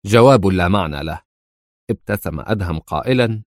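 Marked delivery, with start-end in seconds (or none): 0.1-1.2 a touch of sharpness, a bit louder; mildly irritated dismissal; sharp retort. A narrator's reading. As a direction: snap it back dismissively. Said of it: "قال في شيء من الحدة".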